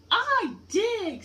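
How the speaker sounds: put-on high, loud little baby voice; little turtle. Baby turtle answers its mother, high and loud. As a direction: squeak it out like an eager baby turtle.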